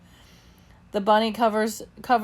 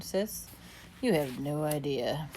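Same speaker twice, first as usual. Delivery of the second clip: groaning; 5 semitones lower, 9 dB quieter than usual. A narrator's reading. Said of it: "I groaned".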